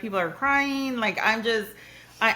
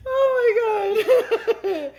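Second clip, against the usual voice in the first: high, slow, loud laughing exclaim; 14 semitones higher, 7 dB louder, much slower than usual. Real, not acted. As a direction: drag it out while cracking up. Laughing at a joke.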